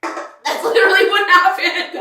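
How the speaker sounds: high, loud, slow laughing confirmation. Real, not acted. Confirming her friend's imitation, laughing loudly. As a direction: laugh it out in disbelief.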